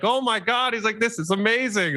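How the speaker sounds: high, thrilled exclamation; imitating a client almost in tears of joy; overjoyed. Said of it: "almost crying on the phone".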